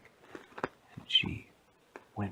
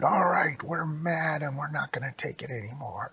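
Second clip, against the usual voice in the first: loud, high opening, then easing; hammed-up indignant announcement; mad bears; 5 semitones higher, 3 dB louder than usual. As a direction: burst in with mock anger. Angry bears confront town.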